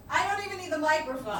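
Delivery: high, quick projection away from the mic; loud American. Shown as a demonstration. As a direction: call it out to the back row, unamplified. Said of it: "Like, we are loud".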